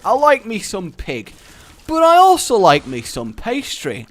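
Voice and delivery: high, loud, fervent declaration; sausage roll inventor. Acted. Inventor pitching a daring pastry idea, excited. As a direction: proclaim your love of sausage and pastry like a bold pioneer. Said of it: "someone who dared to go".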